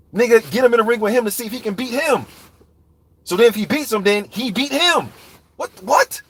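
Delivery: high-pitched, incredulous arguing; baffled and worked up, high; exasperated disbelief. Real, not acted. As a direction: argue back at a ridiculous excuse, pitch climbing. Arguing back at a lame excuse.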